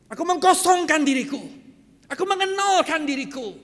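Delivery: loud, high preacher's cry; forceful declaration. Retold or imitated. (seruan lantang penuh tekad)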